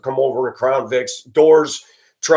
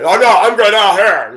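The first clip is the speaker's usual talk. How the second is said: higher, louder mock cop voice, brushing him off; imitating the cop; 6 semitones higher, 7 dB louder than usual. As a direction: play the cop politely refusing, a touch mocking. Voicing the cop at the door.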